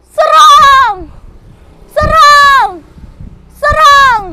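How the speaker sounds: shouted high charge order; battle cry. Acted. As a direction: yell the charge.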